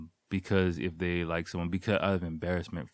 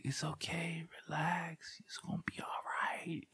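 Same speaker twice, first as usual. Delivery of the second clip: much higher, soft whispered reassurance; smooth; 10 semitones higher, 10 dB quieter than usual. Retold or imitated. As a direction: whisper it all smooth to calm her. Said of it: "try to be all smooth".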